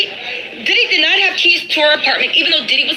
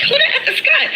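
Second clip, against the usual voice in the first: high, laughing mock outrage; mock bewildered retort; 11 semitones higher, faster than usual. Woman asking angry man who Scott is, high mock disbelief. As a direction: squeak it out in disbelief, half laughing.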